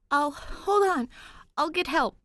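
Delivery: high, loud frightened stammer; panicked ghost voice. Acted. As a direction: blurt it out in panic.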